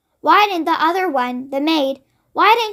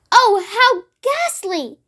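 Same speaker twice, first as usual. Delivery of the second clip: slow horrified cry, higher; cried out; 5 semitones higher, much slower than usual. Acted. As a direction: let the horror stretch the words. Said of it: "the famous voice cried out".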